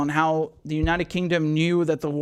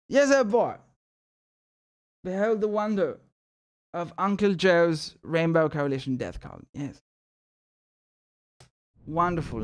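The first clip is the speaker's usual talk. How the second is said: put-on posh British accent. Host unveiling merch to viewers, mock posh British accent. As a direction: present it like a pompous Brit. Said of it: "the D-bag Brit accent".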